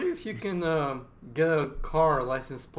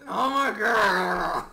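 slow dismayed cry; 6 semitones higher, 4 dB louder, much slower than usual. Real, not acted. Caught in math mistake.

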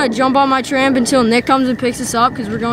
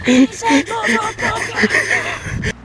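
hammed-up injured wail, higher; mock pain wail; 9 semitones higher, slower than usual. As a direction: overact a fake broken ankle. Mock injury after trampoline fall.

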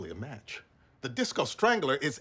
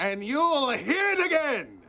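pompous; 13 semitones higher, 4 dB louder, slower than usual. Acted. Pompous insistence.

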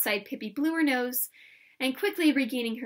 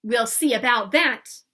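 loud, slow, determined threat; loud, drawn-out challenge; defiant cry; 9 dB louder than usual. Acted. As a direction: announce you're stepping in. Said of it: "cried Pippi".

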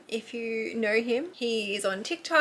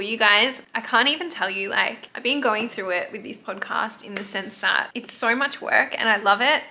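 normal talking voice

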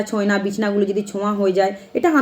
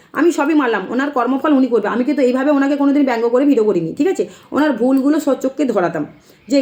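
normal talking voice

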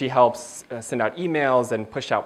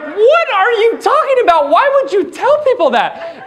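much higher, louder outrage; shocked disbelief; 5 dB louder than usual. Retold or imitated. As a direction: react horrified to mom. Shocked retort to mom.

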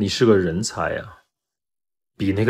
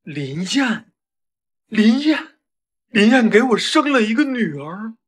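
rising, louder stunned repetition; shocked; 12 semitones higher, 4 dB louder than usual. Acted. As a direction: build from a question to a loud cry. Father learns he has a daughter. (越来越激动地惊呼)

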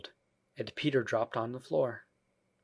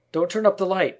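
high, loud plea; frightened cry; 8 semitones higher, 8 dB louder than usual. A narrator's reading. Peter begs Wendy about the light, loud fearful cry. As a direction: cry out to stop her in fear. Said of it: "he cried".

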